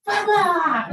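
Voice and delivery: high, loud blah-blah outburst; mock screaming. Mother losing it at her daughter, mock screaming. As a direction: imitate a mother losing it at full pitch. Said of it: "I'm screaming and I'm losing it".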